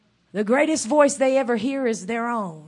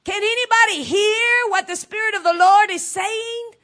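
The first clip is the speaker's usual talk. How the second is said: ordinary talk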